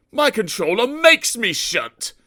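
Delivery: high sheepish little-engine voice; shamefaced and whiny, pitched high. A narrator's reading. Sir Handel sheepishly complaining to Gordon about his work. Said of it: "Sir Handel said sheepishly".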